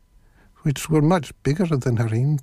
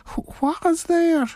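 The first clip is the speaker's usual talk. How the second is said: put-on very high, slow old granny calling out; old woman's voice; slower than usual. A narrator's reading. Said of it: "An old voice called out".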